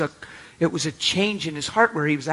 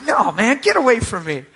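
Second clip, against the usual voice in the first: higher-pitched playful protest; mock annoyed refusal; 6 semitones higher, 3 dB louder than usual. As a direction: push them away, half-joking. Acts out friend refusing prayer.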